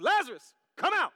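high, forceful calling out; Jesus, loud voice. Acted. Preacher acts out Jesus calling Lazarus, loud shout. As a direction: shout the summons. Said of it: "Jesus called in a loud voice".

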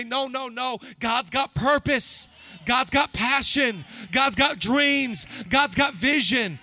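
loud, high, driving sermon cadence; full-force preaching. Acted. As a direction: preach it to the back row.

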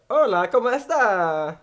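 high, slow, loud mock Spanish greeting; mock Spanish accent. Showing off his mock Spanish accent to friends. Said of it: "I'm like".

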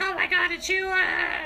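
high, loud retold shriek; mock horrified scream. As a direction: squeal it like a hammed-up horror victim. Acting the victim seeing the chainsaw.

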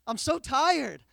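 high, loud, exhausted begging; desperate prayer. Retold or imitated. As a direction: plead with God, worn out. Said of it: "those are the prayers that I'm praying".

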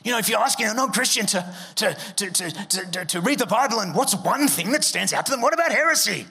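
lampoon the panicked heresy-hunter, stammering; mocking worried objector. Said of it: "let's just get".

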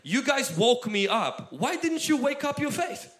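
Jesus asking the sleepy-faithed disciples; Jesus questioning. Retold or imitated. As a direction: press them with a rising challenge. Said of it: "he's asking them".

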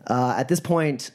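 ordinary talk

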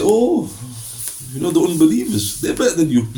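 high, teasing scold; mock chiding. Preacher teases the lazy man in a high mocking scold. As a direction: needle him that unbelievers beat him.

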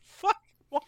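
a sudden high yelp of disbelief; high startled curse; shocked outburst. Real, not acted. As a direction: blurt it out, caught off guard.

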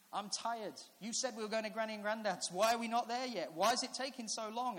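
high, loud, insistent whine; complaining child. Retold or imitated. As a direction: protest like a fed-up little kid. Tired child complaining to dad.

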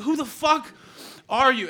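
high, loud incredulous cry; loud mock disbelief; shocked. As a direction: yell it in disbelief at a friend. Narrator's shocked reaction to friend.

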